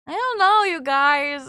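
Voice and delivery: shy high-pitched deflection; flustered. Real, not acted. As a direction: blurt it out, embarrassed. Flustered at compliment.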